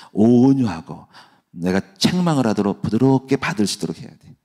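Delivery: ordinary talk, nothing marked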